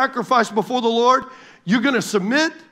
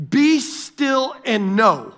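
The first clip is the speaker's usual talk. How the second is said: "Be still and know" stretched out slowly, every word weighted; slow deliberate stress; 3 semitones higher, much slower than usual. Shown as a demonstration. Preacher dwelling on the verse for the church, slow and weighty. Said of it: "I'm putting emphasis on it on purpose".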